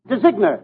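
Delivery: "designer" said as "dezigner", hard g; mispronounced designer. Acted. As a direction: sound the g in "designer": dez-IG-ner. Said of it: "How can you call yourself a French designer?".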